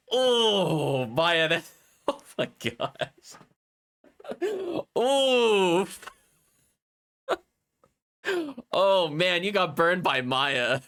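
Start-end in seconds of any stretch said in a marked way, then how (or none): 0.1-7.4 shocked laugh. Real, not acted. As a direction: crack up in disbelief. Shocked by Maya's line.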